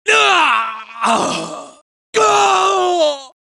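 a high shouted cry as he goes down; death cry. Acted. Robot cries out to his squad as he is destroyed, screaming. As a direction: scream of being blown apart.

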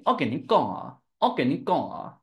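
learner's tentative Hokkien accent; non-native go at Hokkien pronunciation; attempted Hokkien. Shown as a demonstration. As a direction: try the sentence in imitated Hokkien. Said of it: "I know one sentence about that".

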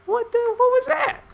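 squeaky high, loud alarm; startled shriek. Real, not acted. Gamer startled by a sound, shrieking very high. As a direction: jump at a sudden noise.

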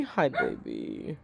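low, slow tender hi. Real, not acted. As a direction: drawl it softly to the dog. Cooing to the dog.

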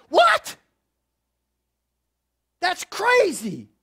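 sky-high, loud disbelief; preacher's shocked outburst at the story; stunned what. Real, not acted. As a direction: yelp it in shock. Shocked reaction to news.

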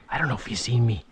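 breathy hush, barely voiced; hushed whisper. Real, not acted. To viewers while stalking a big trout, whispering. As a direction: whisper low so the fish won't spook.

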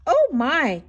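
put-on high, loud cry of an old woman; startled cry. A narrator's reading. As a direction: exclaim loudly in storybook shock. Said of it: "cried the tiny old woman".

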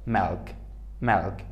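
"milk" said softly and low with its L sounded; L pronounced. Shown as a demonstration. Teacher contrasting with silent L, "milk" with L heard.

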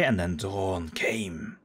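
spooky storyteller. Acted. Slow spooky narration.

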